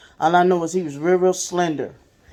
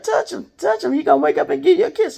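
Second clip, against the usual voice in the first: much higher, insistent coaxing voice; urging older sister; 12 semitones higher, faster than usual. Retold or imitated. Foster sister urging the boy to touch his dead dad, high and insistent. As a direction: coax him toward the casket, repeating it.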